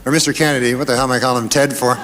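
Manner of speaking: ordinary talk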